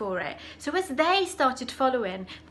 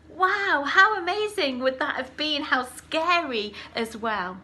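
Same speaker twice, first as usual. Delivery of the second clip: higher, louder wow of wonder; amazed; 4 semitones higher, 4 dB louder than usual. Real, not acted. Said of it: "storyteller's wonder to children".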